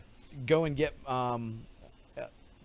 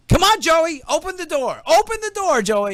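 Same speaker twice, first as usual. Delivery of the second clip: loud, higher yelling through a door; cop shouting; 12 semitones higher, 7 dB louder than usual. Retold or imitated. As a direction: bang on the door and yell for him. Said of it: "And they go".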